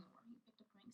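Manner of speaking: soft unvoiced whisper; whispered aside. Real, not acted. Whispering a side note to listeners about her boyfriend. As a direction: tuck it in as a hushed confession.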